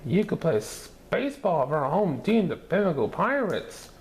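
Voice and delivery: put-on high, eager kids' voices; excited cry. A narrator's reading. Children begging Bob to join the team, excited. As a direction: pitch it up with excitement. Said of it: "cried Scotty".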